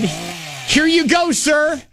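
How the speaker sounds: lightly voiced salesman handing it over; mock polite clerk. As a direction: play the helpful store clerk.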